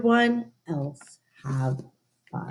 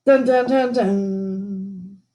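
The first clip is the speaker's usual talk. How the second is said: suspense sting; slower than usual. Pretend. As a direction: hum a spooky suspense sting. Dramatic suspense sound.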